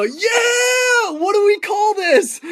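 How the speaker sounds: yelling out in pure stoked delight; two stoned friends cheering a lucky catch, high and loud; excited whoop. Retold or imitated. Stoked after catching the joint.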